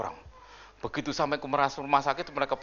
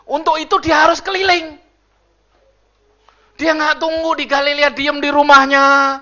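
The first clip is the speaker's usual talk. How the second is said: loud, high preaching voice; preaching at full force; 12 dB louder than usual. Acted. Pastor booming to the congregation, loud and high. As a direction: preach it out to the church.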